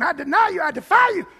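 high, hammed-up shout at the devil; mock quivering defiance. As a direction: ham up a showy devil-denier. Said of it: "we don't quiver in our voice when we're defying Satan".

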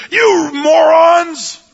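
high, slow, roaring yell; furious shout. Retold or imitated. Moses yelling at the thirsty people, furious shout. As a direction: blast it at them. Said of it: "And Moses said".

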